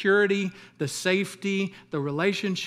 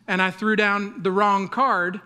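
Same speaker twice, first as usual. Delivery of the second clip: ordinary talk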